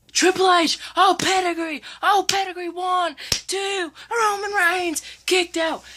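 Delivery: high, fast ringside calling; excited play-by-play. Pretend. Kid retelling the match, calling it like live commentary. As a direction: call the finish like a hyped commentator.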